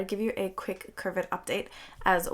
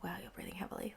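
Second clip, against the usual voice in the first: whisper; 15 dB quieter, much faster than usual. Retold or imitated. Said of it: "and they're like".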